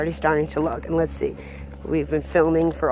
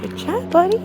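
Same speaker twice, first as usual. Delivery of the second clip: high dog praise; 13 semitones higher than usual. Real, not acted.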